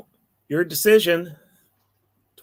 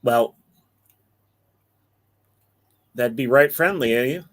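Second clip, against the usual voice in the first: lightly voiced country fellow, lower and easygoing; a hint of a homespun farmhand; folksy character voice; 5 semitones lower than usual.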